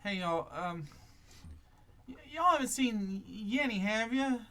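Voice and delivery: high country woman voice; Sally asking the travellers about her missing man, high; Sally's voice. Acted. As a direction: ask like a worried country neighbour. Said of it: "Sally replies".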